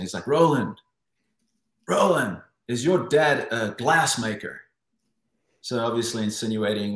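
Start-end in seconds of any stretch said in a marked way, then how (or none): 0.3-4.6 imitated Russian accent, higher and louder. Russian swimmer taunting Roland in the ready room, imitated Russian accent. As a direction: call out like a Russian rival. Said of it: "in his Russian accent".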